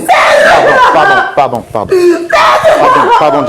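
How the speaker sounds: loud, high, desperate pleas of pardon; frantic pleading. Real, not acted. Host pleading loudly with sobbing Jamila to forgive. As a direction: beg her over and over, loud and urgent. Host begging distraught woman.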